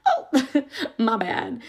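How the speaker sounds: embarrassed laugh through it; laughing apology. Real, not acted. Teacher laughs, embarrassed, at the boy in a boot. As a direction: laugh off the mistake.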